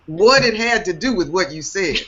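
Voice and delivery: put-on high, playful Southern US accent. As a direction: ham up a Southern accent through laughter. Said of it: "so there's my Southern accent".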